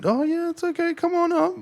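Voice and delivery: high, sing-song friendly reception voice; mock a chirpy, welcoming receptionist; high cheerful receptionist. What the receptionist should have said, in a chirpy high voice. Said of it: "they should have said".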